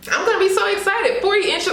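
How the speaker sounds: high, quick burst of excitement; excited. Real, not acted. Vlogger finally finding a long wig, excited. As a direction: rush it out high and thrilled.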